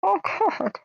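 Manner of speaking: high exasperated oh God; mock dismay. Reacting to mothballs kept for their smell, high mock dismay. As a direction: groan at how absurd it is, way up high.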